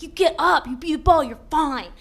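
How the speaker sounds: loud, brisk coaching bark; tough-love coach. Retold or imitated. Mom yelling at her kids to shake it off, loud coaching. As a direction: shout it like a no-nonsense sports mom.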